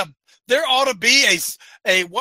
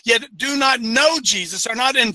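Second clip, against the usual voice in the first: usual speaking voice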